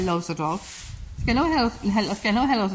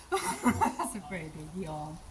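laughing; 4 semitones lower, 9 dB quieter, much slower than usual. Real, not acted. Laughing at horse's yawn.

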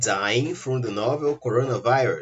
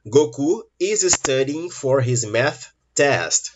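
slow, louder answer sentence; emphatic answer; 3 semitones higher, 4 dB louder, slower than usual. Shown as a demonstration. Teacher giving the full correct sentence to the class, slow and clear. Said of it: "Resposta correta".

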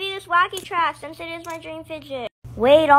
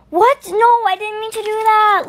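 shocked dismay; 7 semitones higher, 5 dB louder than usual. Acted. Accidentally drops the fidget.

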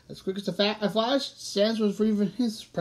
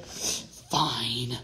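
low, whispery sigh; resigned, barely voiced concession; 7 semitones lower, 4 dB quieter than usual. A narrator's reading. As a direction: give in on a long breath out. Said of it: "he sighed and said".

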